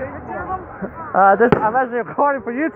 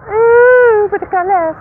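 high, loud wonder at the colours; delighted ooh; 10 semitones higher, 6 dB louder, slower than usual. Real, not acted. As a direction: gasp out in awe then trail off. Cheering at fireworks.

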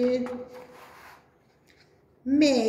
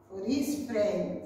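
usual speaking voice

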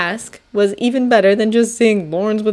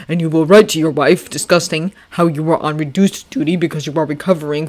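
general speech. Acted. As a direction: scold him firmly but with care. Washington's stern lecture.

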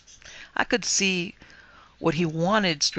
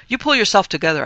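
louder, higher, emphatic command; firm urging; 4 semitones higher, 7 dB louder, faster than usual. A narrator's reading. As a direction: tell him firmly to buck up. Urging the sobbing ghost.

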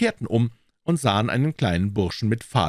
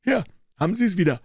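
higher, pushy dealer lecturing a doubter; triumphierender Händler; 12 semitones higher, 5 dB louder, faster than usual. Acted. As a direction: make him read the proof aloud. (auftrumpfender Händler, hohe Stimme)

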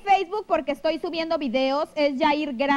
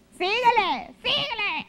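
put-on ugly voice; 6 semitones higher, slower than usual. Shown as a demonstration. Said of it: "Ay, qué feo hablo".

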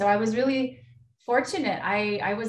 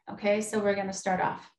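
right in your ear. Acted. Guided breathing intention.

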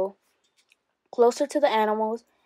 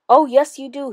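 high sobbing yell, loud at first; yelling between sobs; 4 semitones higher, 8 dB louder than usual. Acted. Frightened Howard yells through sobs at the narrator. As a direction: cry it out, yelling through tears. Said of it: "he yelled between sobbing cries".

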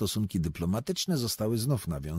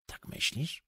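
worried, breathy hush, softer; anxious whisper; 7 semitones higher, 7 dB quieter than usual. A narrator's reading. Berlioz whispers back to the poet, anxious. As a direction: whisper it, uneasy and unsure. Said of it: "szepnął z niepokojem".

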